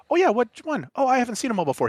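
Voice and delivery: high, bouncy imitated exchange; animated retold chat. Husband replaying small talk with father-in-law, animated. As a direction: lift the pitch as you act both sides.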